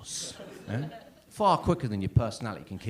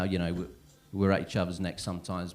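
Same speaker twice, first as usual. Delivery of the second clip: usual speaking voice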